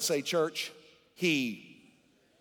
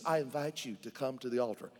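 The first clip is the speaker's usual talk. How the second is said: soft, lower mock invitation; mock gentle invitation; 5 semitones lower, 5 dB quieter than usual. Pastor mocking a timid altar call, softer and lower. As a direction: play the meek version you reject. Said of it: "No, he said".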